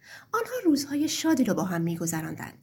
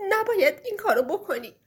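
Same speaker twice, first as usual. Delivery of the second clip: tearful pleading, voice higher and breaking; crying kind wife pleading; 7 semitones higher, 4 dB louder than usual. Acted. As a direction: beg through sobs not to send him away. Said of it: "گریه کرد و گفت".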